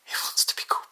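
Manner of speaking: amused whisper aside. Real, not acted. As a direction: whisper it to your partner. Laughing aside to partner.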